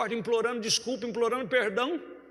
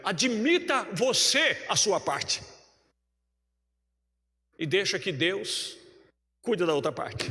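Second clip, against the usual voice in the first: slow and solemn; slower than usual. Real, not acted. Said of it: "slow exhortation to the church".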